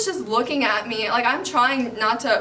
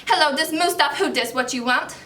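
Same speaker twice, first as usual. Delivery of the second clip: put-on phone character, pitched up at the start; Mustafa Al-Zakari; 3 semitones higher than usual. Girl picking up a call from India in character. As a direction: play the made-up man on the phone. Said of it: "So I have this character, his name is Mustafa".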